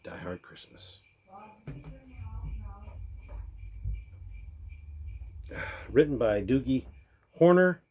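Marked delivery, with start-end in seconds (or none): 0.0-1.0 breathy hushed whisper of the title. Acted. 2.1-5.8 long airy sigh; dreamy sigh. Acted. Long breathy sigh after title.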